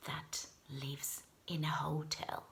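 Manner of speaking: soft unvoiced whisper. Real, not acted. Reader teasing the hotel dinosaur, whispered. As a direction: hush it like a secret.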